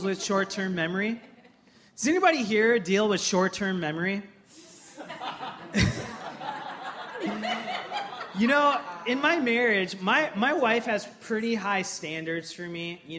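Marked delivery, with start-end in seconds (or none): 5.0-8.3 soft laugh. Real, not acted. Laughing at own joke.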